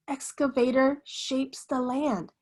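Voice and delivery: usual speaking voice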